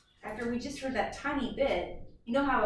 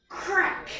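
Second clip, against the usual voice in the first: high, loud sharp crack; dramatic crack; 10 semitones higher, 6 dB louder than usual. Acted. Teacher acting out the ice breaking, high loud crack. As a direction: snap the word out like breaking ice.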